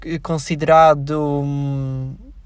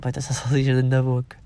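softer, lower repeat with a laugh; giggling as it sounds rude; quiet amused take of the same line; 5 semitones lower, 5 dB quieter than usual. Real, not acted. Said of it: "Agora parecia bem sexual".

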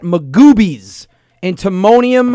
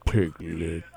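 very slow, low mopey drawl; droopy cartoon dog voice; 6 semitones lower, much slower than usual. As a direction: sound like a sleepy droopy dog. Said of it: "That's what you sound like right now".

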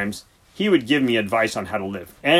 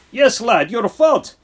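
emphatic blame; 13 semitones higher, 10 dB louder, slower than usual. Acted. Siegfried blames James emphatically.

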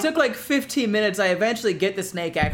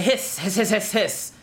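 mock snake hissing. Speaking the snake's language, a light mock hiss. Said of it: "speak the snake's language".

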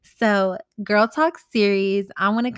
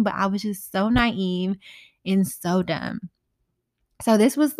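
ordinary talk

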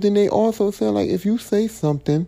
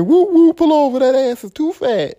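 loud, high-pitched hooting catcall; acted catcall; 10 semitones higher, 6 dB louder than usual. Man catcalling a woman at work, loud mock whooping.

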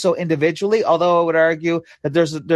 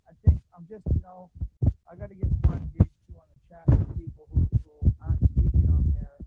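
muffled, mic blocked by a hand; muffled bass only; 3 semitones higher, 6 dB quieter than usual. Real, not acted. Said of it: "You sound muffled".

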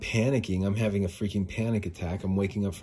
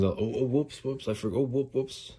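higher, put-on careless excuse; mock oopsy; 5 semitones higher than usual. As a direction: imitate a feigned little slip-up. Mocking someone's excuse for forgetting.

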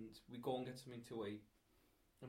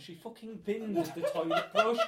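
much higher, louder and slow, laughing; laughing disbelief; 7 semitones higher, 11 dB louder, slower than usual. Real, not acted. Telling friends about the binned brush, laughing outraged, higher and louder. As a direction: spell out the outrage through laughter.

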